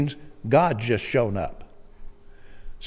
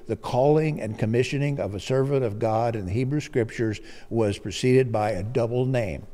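ordinary delivery

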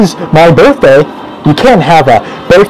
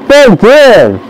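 talking through a mouthful of cookie; mouth full; 5 semitones higher than usual. Acted. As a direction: chew and mumble it out. Eating cookies while talking.

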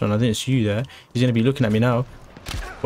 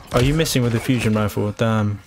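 normal talking voice

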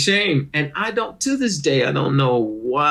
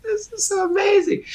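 awed. Retold or imitated. Retells his awe watching comedy.

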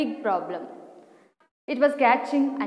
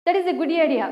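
higher, louder praise; keen approval; 7 semitones higher, 6 dB louder, much faster than usual. A narrator's reading. Old mouse to little mouse, keen approval. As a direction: praise it brightly and emphatically.

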